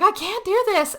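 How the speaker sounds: high-pitched mock whine; pleading refusal. Luke retold refusing to hurt his knee, pleading high. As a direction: plead it high, acting desperate.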